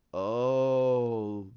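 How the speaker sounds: long drawn-out low "oh"; lightly acted slow realization; retold dawning realization. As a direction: let the oh stretch as it sinks in.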